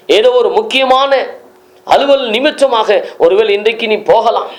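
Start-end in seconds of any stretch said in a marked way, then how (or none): none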